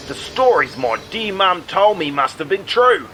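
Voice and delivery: ordinary talk, nothing marked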